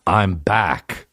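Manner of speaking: put-on low lightly done Austrian Terminator accent; a hint of Arnold's Austrian drawl; Schwarzenegger accent. Mocking Terminator line, light Austrian accent. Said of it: "and he's like".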